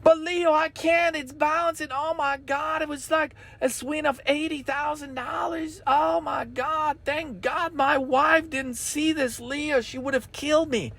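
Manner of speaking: high, panicky mock trader voice; panicked trader. Follower panicking to Leo about his account, high and frantic. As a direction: play a frantic follower losing money. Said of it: "people that are just screaming".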